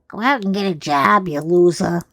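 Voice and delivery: a hint of a nagging wife, quick and loud; hammed-up scold to a jobless husband; mock nagging wife. Mock nag telling husband to get a job. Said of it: "I'm not the type to".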